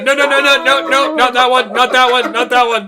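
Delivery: loud, high, rapid-fire alarm; frantic protest. Real, not acted. Game master stopping a player handing over a card, loud frantic protest.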